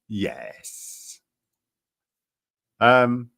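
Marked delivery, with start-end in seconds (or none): 0.1-1.2 very low, soft sarcastic yes. Real, not acted. Streamer making sure listeners catch it, low sarcastic tone. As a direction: drop the voice into a knowing, sarcastic yes. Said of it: "I hope you can hear the sarcasm in my voice".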